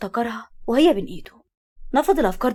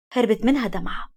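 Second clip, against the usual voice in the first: plain reading voice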